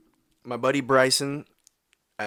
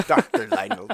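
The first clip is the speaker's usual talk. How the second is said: laughing; 10 semitones higher, 4 dB louder than usual. Real, not acted. Laughing echo of the title.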